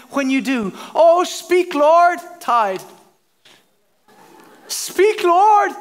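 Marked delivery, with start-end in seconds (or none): 0.9-2.2 loud, high mock-fervent plea; eager prayer. Acting eager believer praying to God. 4.7-5.7 loud, high mock-fervent plea; eager prayer. Acting eager believer praying to God.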